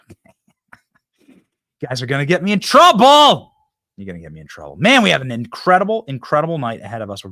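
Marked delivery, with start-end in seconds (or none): 0.1-3.5 loud laughing protest, voice lifted. Real, not acted. Laughs at cohost's joke. 4.0-4.8 much lower and softer mutter; under breath. Real, not acted.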